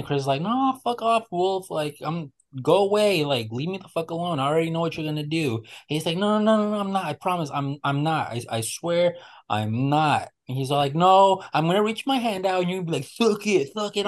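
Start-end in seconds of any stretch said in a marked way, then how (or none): 0.4-5.6 higher, exasperated fuck-off voice; mock annoyed refusal. Annoyed classmate telling a pest to leave, exasperated. Chris angrily rejecting Wolf. 6.2-10.3 higher, insistent pleading; mock pleading denial. Prankster swearing to Chris he won't, mock pleading. 10.9-14.1 higher, mocking impression of the taunt; mock mimicry. Annoyed friend mimicking the prankster, higher mock.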